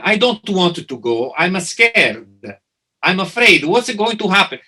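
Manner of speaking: put-on Italian-accented English, anxious and pleading. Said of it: "I'll say it with my Italian".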